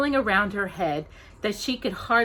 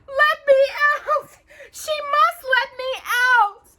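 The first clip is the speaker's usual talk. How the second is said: very high, loud tearful cry; 8 dB louder than usual. Acted. Cinderella locked in her room, crying to be let out. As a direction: cry and shout through the door.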